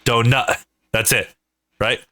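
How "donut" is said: "Donut" stopped short, T unreleased; dropped final T. Shown as a demonstration. Demonstrating East Coast speech, "Donut" halted before the T. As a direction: cut "Donut" off at the U. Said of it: "and you just stop".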